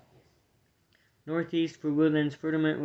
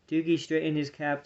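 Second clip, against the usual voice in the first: usual speaking voice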